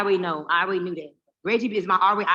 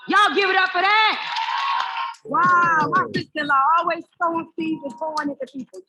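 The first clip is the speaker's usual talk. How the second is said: loud, high crowd-hyping call; hype shout; 7 semitones higher, 6 dB louder than usual. Real, not acted. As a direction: fire up the room for applause. Hype host calls applause.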